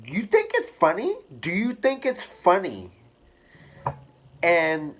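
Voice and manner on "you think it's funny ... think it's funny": higher, fast, accusing mother; angry mom. Retold or imitated. Offended mom scolds laughing son, higher and fast. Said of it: "And she was like".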